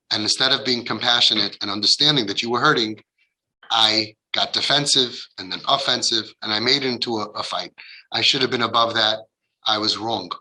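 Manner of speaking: low, subdued, sincere confession; contrite admission. Acted. Parent admitting fault to a hurt daughter, contrite and low. Models a parent's gentle apology.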